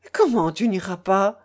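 ordinary delivery, nothing marked

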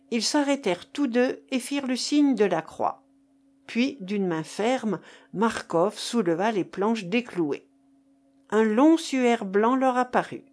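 ordinary delivery

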